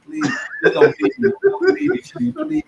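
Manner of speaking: high, whimpering fake sob; hammed-up crying beg; mock crying plea. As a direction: beg through mock tears. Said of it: "you're rumbling in your throat".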